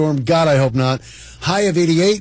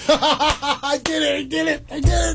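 laughing; 10 semitones higher than usual. Real, not acted. Embarrassed after doing dog voice.

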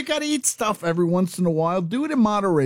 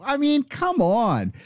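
exasperated; 7 semitones higher, slower than usual. Real, not acted. Ranting about Oreo critics.

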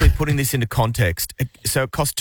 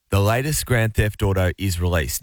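ordinary talk, nothing marked

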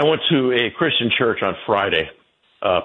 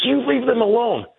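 much higher, pushier mock of her repeat; shrill mock insistence; 13 semitones higher than usual. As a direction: raise her voice high, insisting. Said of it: "She goes".

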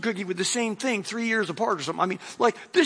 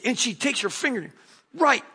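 ordinary talk, nothing marked

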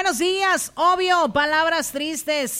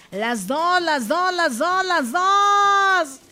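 building call-out; 3 semitones higher, 5 dB louder, slower than usual. Real, not acted. Radio host calling out the requested two songs, building to a shout. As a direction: chant building to a drawn-out high shout.

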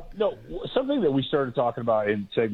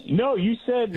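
much higher, teasing complaint; playful accusation; 10 semitones higher than usual. Real, not acted. Cohost teasing the host for breaking his promise, high and playful. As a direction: call him out jokingly. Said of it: "Uh-oh".

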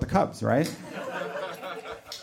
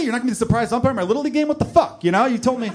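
put-on high, rushed protest of a let-down child; mock outrage, higher pitch; indignant kid; 7 semitones higher, faster than usual. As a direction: whine upward like a cheated kid.

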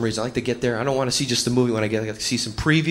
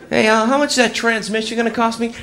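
higher-pitched, casual customer asking a question; raised, innocent inquiring voice; ordinary customer; 8 semitones higher than usual. Acted. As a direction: play an everyday guy at the garage. Customer questions the trailer-voiced mechanic.

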